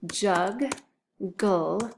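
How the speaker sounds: JUGGLE as two separate beats, "jug, gull"; split into syllables. Shown as a demonstration. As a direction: say "jug", pause, then "gull". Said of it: "listen closely to those syllables".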